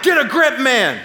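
loud, high-pitched telling-off; mock drill-him-straight outburst; Gordon Ramsay. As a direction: snap at a blubbering man to pull himself together. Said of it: "I went all Gordon Ramsay on him".

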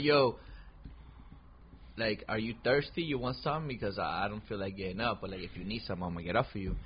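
low, soft, caring offer; soft and tender. Retold or imitated. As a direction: speak gently, half asleep. Tender offer after sex.

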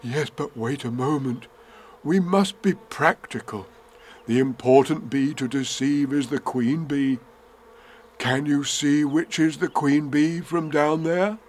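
lightly voiced, earnest storybook bear; a hint of a practical bear; Winnie the Pooh. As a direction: reason it out as a little bear.

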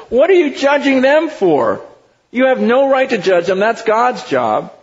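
high, emphatic challenge; indignant rebuke. Acted. God rebuking the church for judging outsiders, indignant.

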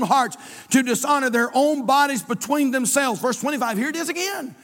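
fiery pulpit reading; preaching. Acted. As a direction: preach it out high and loud.

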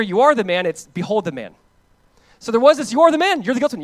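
high, loud accusation; loud, high accusation of guilt; loud accusing cry. Acted. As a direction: cry out the charge against us. Preacher voicing accusation.

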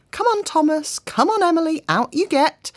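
put-on high, loud sing-song call to little children; calling to small kids. Flora calling her small kids out of the car, sing-song and high. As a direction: coax the little ones out like toddlers.

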